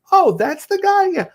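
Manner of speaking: very high-pitched mock delight; surprised gushing. Wife discovering who the friendly artist is, gushing. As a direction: exclaim high, then stretch out the praise.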